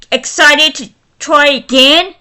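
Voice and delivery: usual speaking voice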